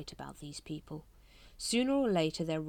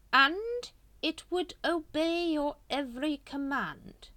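slow, raised, silky persuading; seductive tempter; 10 semitones higher, 5 dB louder, slower than usual. A narrator's reading. Grand Master tempting Watchtower, slow and silky. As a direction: dangle the offer slowly.

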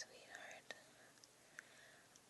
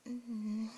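savouring moan; 7 dB louder than usual. Acted. Savouring moan mid-scene.

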